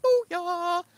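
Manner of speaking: very high whooping booyah; squeaky high booyah; mock booyah. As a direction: cheer your win. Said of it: "I was like".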